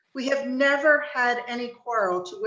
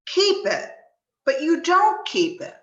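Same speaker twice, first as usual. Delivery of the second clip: indignant protest; 6 semitones higher, 5 dB louder than usual. Acted. Nephew protests uncle's Christmas scorn.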